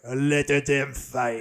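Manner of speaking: put-on slow, heavily accented film-hero line; Ken Watanabe. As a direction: deliver it slowly and solemnly in a put-on Japanese accent. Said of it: "and he's like".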